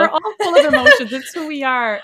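real laughing, high-pitched outburst. Real, not acted. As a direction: laugh as you declare it loudly. Laughs while owning her emotional nature.

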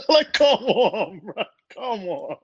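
laughing high protest. Real, not acted. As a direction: laugh it out in disbelief. Laughing at the Google result.

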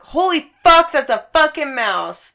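high, loud reenacted shock; shocked outburst. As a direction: blurt it out in mock horror. Shocked discovery of a dead mouse.

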